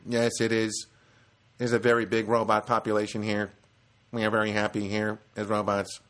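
level, evenly spaced robotic delivery. Retold or imitated. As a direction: state it like a contented machine, no inflection. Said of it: "She really, really was quite a robot".

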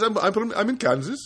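put-on high Indian accent with questioning rise. Phone agent giving his location, questioning Indian accent. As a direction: imitate an Indian accent, lifting the end. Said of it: "It sounds like he's asking a question".

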